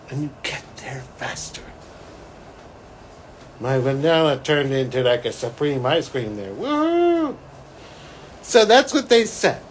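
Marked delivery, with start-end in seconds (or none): 0.1-1.6 soft secretive whisper. Real, not acted. Telling listeners the shortcut, whispered aside. 6.5-7.4 high joyful woo-hoo; happy whoop. Real, not acted. Celebrating the vanilla turning Supreme, high joyful whoop. Celebrating ice cream upgrade.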